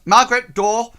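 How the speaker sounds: loud, high-pitched shout for someone outside; shouted order. Acted. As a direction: shout a curt command through to the next room. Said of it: "And then she shouts".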